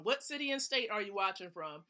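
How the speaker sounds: ordinary talk, nothing marked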